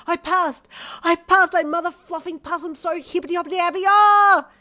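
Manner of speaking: high, loud, overjoyed shrieks; ecstatic shrieking. Acted. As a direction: scream with joy and disbelief. Exam results passed scream.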